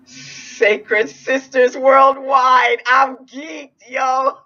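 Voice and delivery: high, loud, slow ecstatic cheer; ecstatic shout. Real, not acted. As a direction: belt it out with pure joy.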